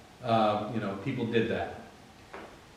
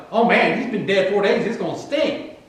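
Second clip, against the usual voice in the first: higher-pitched comic protest; mock dismay; 6 semitones higher than usual. Martha objecting at the tomb, mock dismay. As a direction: blurt out a hammed-up objection. Martha's instinctive reaction acted out.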